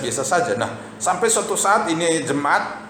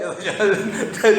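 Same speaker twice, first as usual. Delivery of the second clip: laugh; 5 semitones higher than usual. Real, not acted. Funny story about daughter.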